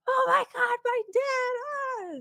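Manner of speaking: mock-hysterical high-pitched wail; hysterical screaming. Instructor playing a frantic relative to shock students, hysterical screaming. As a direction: act out a panicked, over-the-top shriek. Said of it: "comes out from the back just screaming".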